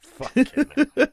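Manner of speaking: high, loud laugh into the swear, slowed; incredulous laughing outburst; laughing disbelief. Real, not acted. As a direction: crack up at what your mate said. Friend says "blessed.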